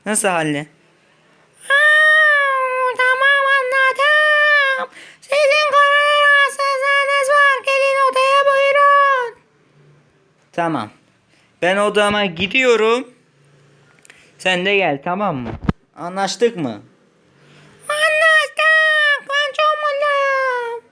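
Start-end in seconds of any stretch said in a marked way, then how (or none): 1.7-9.4 very high, louder cartoon doctor voice, stretched; loud squeaky doctor. Doctor invites the sick patient in, squeaky and loud. 17.9-20.8 very high, louder cartoon doctor voice, stretched; loud squeaky doctor. Doctor invites the sick patient in, squeaky and loud.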